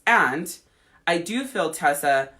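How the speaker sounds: ordinary talk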